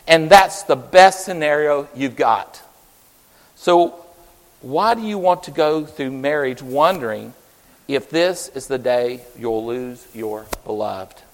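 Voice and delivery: preacher's stern counsel. Retold or imitated. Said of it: "He said".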